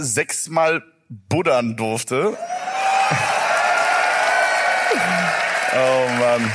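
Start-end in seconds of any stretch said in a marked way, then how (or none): 2.4-5.7 burst of laughter. Real, not acted. Reacts to a cheeky Tinder-bio thanks.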